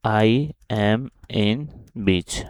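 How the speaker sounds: very slow, word by word as he types it; slow dictation while typing. Shown as a demonstration. Teacher dictating the text to students, slowly. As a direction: spell it out slowly for the block. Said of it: "इसके साथ भी हम लिख लेते हैं".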